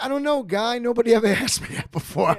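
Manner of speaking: high, loud stammering disbelief; stunned Jay Leno. Retold or imitated. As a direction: play him caught off guard, voice jumping up. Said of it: "he was like".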